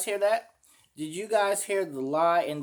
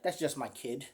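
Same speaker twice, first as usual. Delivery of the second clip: mocking dismissive tone; 3 semitones lower, 8 dB quieter than usual. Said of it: "the way he said it was like".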